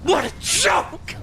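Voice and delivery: slow, shouted dismissive dad; mock dad scorn. Caster voicing a harsh father mocking the child's grade. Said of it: "great father".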